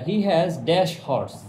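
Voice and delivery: very slow, word-by-word reading; slow dictation. Shown as a demonstration. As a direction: read it out slowly for the class to follow. Teacher reads exercise sentence aloud. (ধীরে ধীরে বাক্য পড়া)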